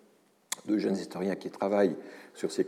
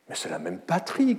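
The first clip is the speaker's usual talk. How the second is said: sergeant's shout; 10 semitones higher than usual. Retold or imitated. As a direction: exclaim it as a soldier sure of his point. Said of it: "cria le sergent".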